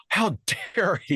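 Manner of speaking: gasping, high mock indignation; mock outrage. Host mocking the outraged men, high and scandalized. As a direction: ham up their shocked outrage.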